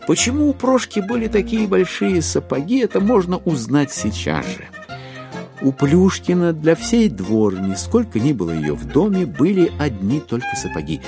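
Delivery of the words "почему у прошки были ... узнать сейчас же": normal talking voice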